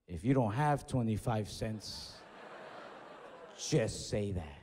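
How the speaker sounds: low, slow, drawn-out put-down; condescending jab. Retold or imitated. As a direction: spell it out slowly and low, mocking the cashier. Retold cashier's condescending jab at customer.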